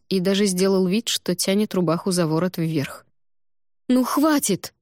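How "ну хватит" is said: sharp, high angry burst; angry outburst. Acted. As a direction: snap in exasperation. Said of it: "разозлилась я".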